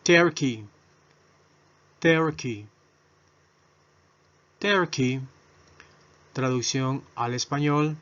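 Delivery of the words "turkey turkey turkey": Turkey said as "terki", loud and crisp; model pronunciation. Shown as a demonstration. Teacher drilling Turkey, loud and crisp. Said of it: "Veamos otro término".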